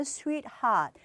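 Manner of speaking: sweetheart drawn out slowly; sweetheart, slow demo. Shown as a demonstration. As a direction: draw out sweetheart. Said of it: "보시면".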